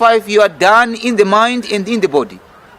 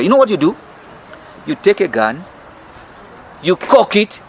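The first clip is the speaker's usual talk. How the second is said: ordinary delivery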